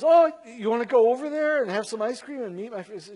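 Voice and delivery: husband eagerly invites his wife out for ice cream, excited and laughing; eager invitation. Retold or imitated. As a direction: burst out with the idea, grinning.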